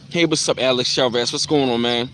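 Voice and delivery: usual speaking voice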